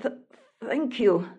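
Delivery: halting, stuttered thanks; stuttering. Acted. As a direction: stumble on the first sound. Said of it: "stuttered the prince".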